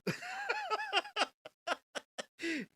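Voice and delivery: squealing laugh; high giggle. Real, not acted. Host repeats the line, high giggle.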